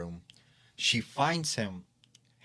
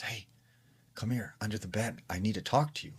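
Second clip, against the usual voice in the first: ordinary delivery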